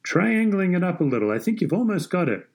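high-pitched, encouraging coaching call; understated yell, pitched well up; mild yell. A narrator's reading. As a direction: call a tip across the court, keeping volume modest. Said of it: "Harry yelled".

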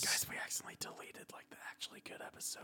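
hushed whisper to the audience. Real, not acted. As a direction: whisper it, unvoiced and fast. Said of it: "Can you hear me when I'm whispering?".

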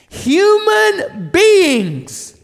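a soaring preacher's declaration; proclaimed reveal. Acted. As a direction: proclaim it to the whole room. Repeat of hushed reveal, now proclaimed.

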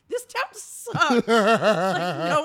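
slow, loud, laughing gripe; laughing mock complaint. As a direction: whine it through laughter. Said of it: "she's like".

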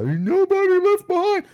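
high, put-on voice for the slogan; mock politician voice high above his own; mock George Bush slogan. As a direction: lampoon the old slogan in a silly high voice. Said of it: "This isn't the George Bush era".

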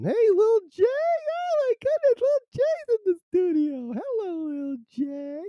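very high, squealing greeting; very high excited squeal; squeaky excited voice. Real, not acted. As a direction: squeal hello like an overexcited fan. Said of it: "I just annoyed myself. If I talk like that".

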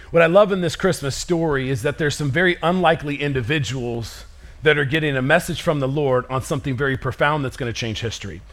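usual speaking voice

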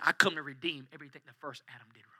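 a quick hushed whisper; soft, fast whisper of the gardener. Acted. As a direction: breathe it like a secret revealed. Risen Jesus speaks as gardener.

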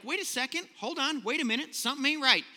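high, startled wait a second; sudden realization. Retold or imitated. Preacher voicing brothers, sudden alarm. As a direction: brothers catch themselves mid-plot.

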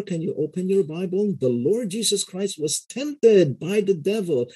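high-pitched, dramatic sermon with swooping volume; Sunday pulpit preaching. Shown as a demonstration. Preacher to Sunday congregation, dramatic and high-pitched. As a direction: preach it to grab a mixed congregation. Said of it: "when I'm preaching from my Sunday pulpit".

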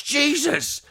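high, startled yelp imitation; retold shout. Wife at the closing lift doors, imitated high shout. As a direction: imitate a shocked cry, pitched right up. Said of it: "she just shouts".

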